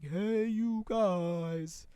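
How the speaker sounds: long, high, loud call; imitated holler. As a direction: holler it, stretching every word. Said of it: "the girl's like".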